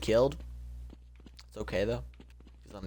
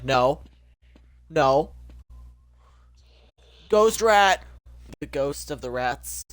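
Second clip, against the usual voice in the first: ordinary talk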